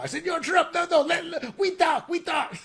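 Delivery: very high, hammed-up mock Chinese-Panamanian accent; mock China-from-Panama accent. China calling to Trump from Panama, mock accent. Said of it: "This is a China from Panama".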